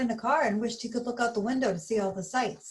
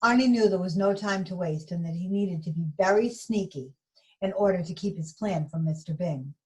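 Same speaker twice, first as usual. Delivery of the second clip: usual speaking voice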